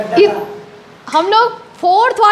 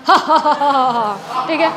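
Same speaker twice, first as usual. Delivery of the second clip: lightly voiced villain's booming ha ha ha; a hammed-up demon-king belly laugh, slightly lower; mock roaring laugh of Ravan; Ravan's laugh; 4 semitones lower than usual. Shown as a demonstration. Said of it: "जैसे रावण हंसता था".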